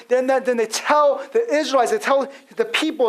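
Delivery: ordinary talk, nothing marked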